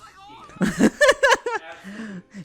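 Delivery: high laughing burst; burst of laughter. Real, not acted. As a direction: crack up at yourself. Laughs at own impression.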